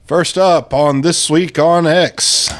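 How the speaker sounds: hosting voice; announcer voice. Acted. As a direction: announce the segment to viewers. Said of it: "show segment announcement".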